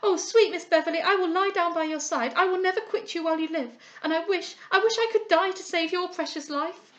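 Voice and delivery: high, loud tearful pledge; girl clinging to a dying friend; anguished devotion. A narrator's reading. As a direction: swear never to leave her side.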